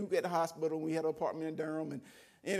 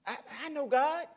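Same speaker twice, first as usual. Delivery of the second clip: higher, insistent retort; retold loud argument; 8 semitones higher, 3 dB louder than usual. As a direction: push back, retelling a quarrel. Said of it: "a loud discussion".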